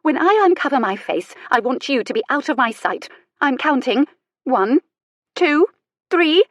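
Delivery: high, loud indignant countdown; acted indignant warning; outraged ultimatum. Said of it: "shoulders heaving in outrage".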